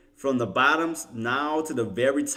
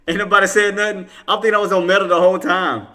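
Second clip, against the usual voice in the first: loud, higher exclaim of disbelief; mock outrage; 6 semitones higher, 9 dB louder than usual. Real, not acted. Streamer loudly protests to chat, mock outrage. Realizes chat never warned him.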